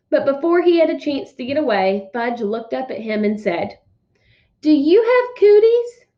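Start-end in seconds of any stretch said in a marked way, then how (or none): 4.6-6.1 put-on much higher, loud, slow little-boy voice; high little-kid question. Small boy asking the waiter, high loud kid voice. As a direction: ask it wide-eyed like a small child.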